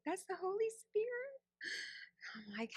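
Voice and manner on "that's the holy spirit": put-on 1 teasing girlfriend. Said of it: "she'd be like".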